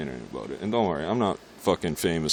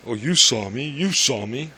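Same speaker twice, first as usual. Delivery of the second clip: higher, louder mock accusation; mocking police; 6 semitones higher, 6 dB louder, slower than usual. Mocking the police who yell at him, high and loud. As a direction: sneer it like a cop who thinks you saw him.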